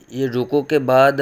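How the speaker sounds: normal talking voice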